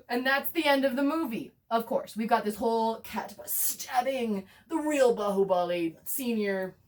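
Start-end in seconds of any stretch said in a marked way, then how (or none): none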